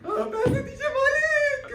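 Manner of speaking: high, excited plea for money; eager begging. Acted. Servant eagerly asks master for cash, high and excited. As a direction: squeal for the reward.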